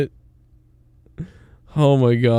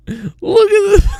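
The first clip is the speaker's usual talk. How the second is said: squealing with laughter; slower than usual. Real, not acted. Laughing at a lookalike photo.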